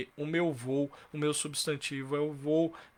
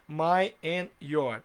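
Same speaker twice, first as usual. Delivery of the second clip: slow "My and your" posed as a question to judge; stretched test sentence; slow quiz phrase; much slower than usual. Shown as a demonstration. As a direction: hold each word, "My and your". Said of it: "Tá certo ou tá errado?".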